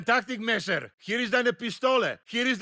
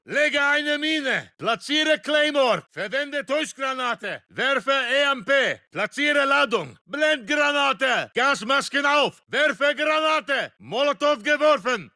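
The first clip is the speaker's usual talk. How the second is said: raised, forceful soldier's call; combat callout; 3 semitones higher, 4 dB louder than usual. Acted. Soldier warning squad of weapons being used, shouted. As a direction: call it out over gunfire.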